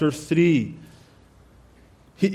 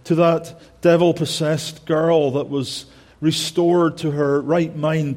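ordinary talk

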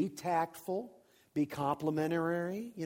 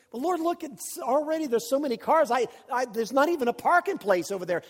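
high-pitched, stammering protest; acted-out wheedling in a raised voice; pleading excuses; 8 semitones higher, 5 dB louder than usual. Preacher argues with the Lord about parking, high pleading protest.

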